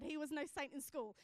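usual speaking voice

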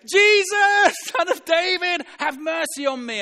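very high, loud drawn-out cry. Acted. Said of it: "he shouted all the more".

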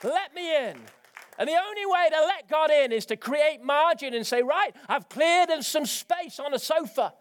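high, loud preaching voice; fervent preaching. Acted. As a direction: preach with full passionate fire. Preacher at full force.

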